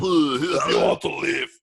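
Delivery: slow mock Austrian threat; Arnold Schwarzenegger impression. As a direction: drag it out menacingly like Arnold. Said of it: "part fucking Arnold Schwarzenegger".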